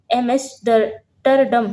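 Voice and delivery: very slow, drawn-out एमेस्टरडम; syllable by syllable. Shown as a demonstration. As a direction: break एमेस्टरडम into separate, stretched chunks. Teacher breaks up a hard name. (एमेस्टरडम को धीरे-धीरे तोड़कर बोलना)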